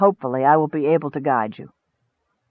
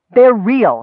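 louder, higher irritated snap; snappish; 5 semitones higher, 5 dB louder than usual. A narrator's reading. Wizard answering the goblin's suspicion of the gold, snapping. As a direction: snap it back at a doubter. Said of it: "snapped Akar".

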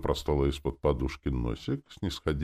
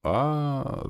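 high, long "ah" of relief; relieved; 11 semitones higher than usual. A narrator's reading. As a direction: let out a relieved ah. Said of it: "сказал я с облегчением".

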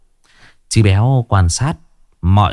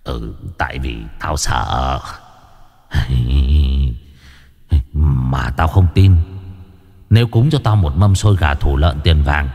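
sly ghost; 7 semitones lower than usual. A narrator's reading. One-eyed ghost haggles for offerings, low and sly.

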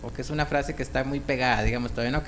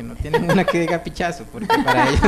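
laughing; 3 semitones higher, 6 dB louder than usual. Retold or imitated. Retelling foreigners' crude requests.

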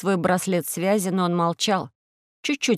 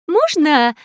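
eager blurt; 9 semitones higher, 6 dB louder, slower than usual. Acted. Nurse interrupts.

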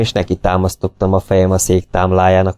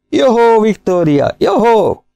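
much higher, louder gleeful hail; delighted greeting; 14 semitones higher, 3 dB louder, slower than usual. A narrator's reading. Granville gleefully greets Victoria the terrier, much higher and louder. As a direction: hail the little dog with joy.

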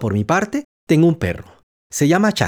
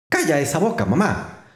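usual speaking voice

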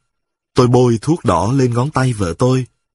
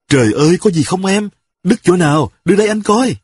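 flustered panic; 7 semitones higher than usual. A narrator's reading. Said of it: "tôi càng quýnh quáng".

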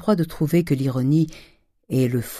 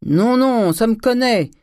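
loud, high shouted refusal; calling back; 9 semitones higher, 5 dB louder, slower than usual. A narrator's reading. Master answering his servant from the staircase, calling back. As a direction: wave off help in a raised, high voice.

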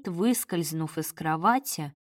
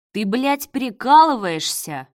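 higher and louder, a shout right in his face; furious yell; 4 semitones higher, 8 dB louder than usual. A narrator's reading. Marat yells into his partner's face: furious shout. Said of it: "проорал Марат ему в лицо".

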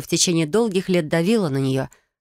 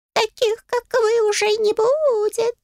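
high, drawn-out cat lament; theatrical sigh; slower than usual. A narrator's reading. Cat handle mourning its favourite girls, theatrical. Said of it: "картинно вздохнула ручка".

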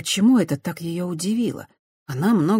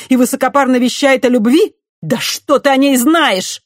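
much higher and louder, scornful; loud contempt; 8 semitones higher, 9 dB louder than usual. A narrator's reading. Christiana loudly scorning the priest as a coward. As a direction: pour scorn on him loudly. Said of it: "Напоказ, громко, презрительно".

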